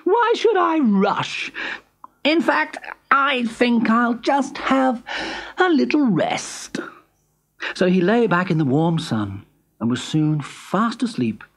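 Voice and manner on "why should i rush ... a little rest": high, slow, lazy hare voice; lazy smug hare. Acted. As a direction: drawl out his overconfidence. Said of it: "thought Hare".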